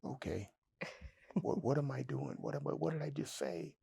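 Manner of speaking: soft, fast worried mumble to himself; quiet dread at his own words; hushed panic. Retold or imitated. Quiet panic after confession.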